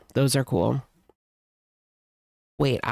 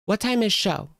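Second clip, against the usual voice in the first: higher, sing-song mockery of her question; mocking condescension; 7 semitones higher than usual. Mocking the lady's condescending line, higher pitched. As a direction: talk down like she did. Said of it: "That's so condescending".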